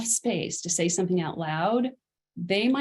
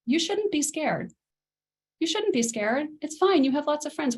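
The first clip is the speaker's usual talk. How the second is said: higher, brisk brushing-off parent; dismissive reassurance; 7 semitones higher than usual. Shown as a demonstration. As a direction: wave the fear away, the wrong way. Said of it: "what they wanna hear isn't".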